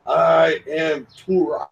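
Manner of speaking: slow, loud, grand proclamation; Captain Kirk declaration. Retold or imitated. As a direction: announce it like a TV hero, drawn out. Quoting Kirk's declaration on the show.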